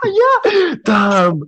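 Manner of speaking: high, loud excited yelp; real excited cry. Real, not acted. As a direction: shout it out in delight. Reacting to joke, laughing.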